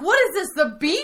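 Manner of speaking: high, loud mock disbelief; hammed-up incredulity, voice jumping up; incredulous question. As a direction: act out an appalled boss finding something odd. Retold incredulous question from boss.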